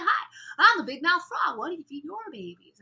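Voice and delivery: high, wide-mouthed frog, loud at first; big mouth frog. Acted. Frog meets a bird and asks about babies, big mouth voice. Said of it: "his mouth is really big".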